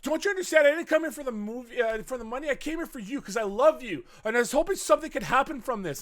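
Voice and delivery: high-pitched, hammed-up emotional confession; mock impassioned pleading. Maria confesses her love to Batista, mock impassioned pleading.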